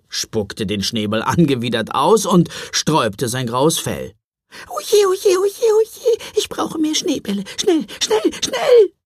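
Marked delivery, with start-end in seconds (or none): 4.7-8.9 very high, loud squeaky cries; panicked gingerbread man. Acted. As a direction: yell in panic like a tiny cookie. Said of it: "rief der Lebkuchenmann".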